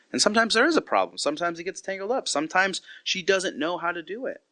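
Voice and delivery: normal talking voice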